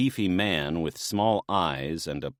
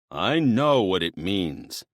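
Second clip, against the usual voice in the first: slower, louder, a touch of irritation; irritated retort; 3 semitones higher, 5 dB louder than usual. Acted. Dionysus mildly irritated, answering the maenads' teasing. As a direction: lean on it, mildly annoyed. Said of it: "Dionysus glared".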